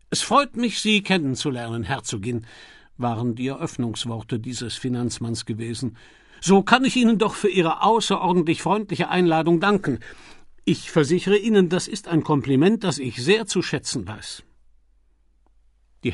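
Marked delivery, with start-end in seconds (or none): 0.1-2.5 hearty American financier. Acted. Milligan greets the Duchess at lunch, hearty and loud. 6.4-14.4 higher, louder booming businessman greeting; hearty American financier. Acted. Milligan greets the Duchess at lunch, hearty and loud.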